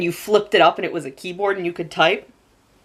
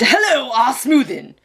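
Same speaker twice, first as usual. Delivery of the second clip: high, slow, pushy put-on voice; aggressive caller voice; 6 semitones higher, slower than usual. Mystery caller greeting her on the phone, aggressive put-on voice. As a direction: imitate a strange caller's thick, aggressive greeting. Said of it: "In that accent, with that kind of, like, aggression".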